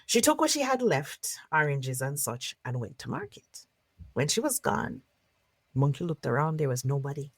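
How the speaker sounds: lively storyteller narration; story narration. Acted. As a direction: tell it to a circle of listeners. Storyteller narrates folk tale.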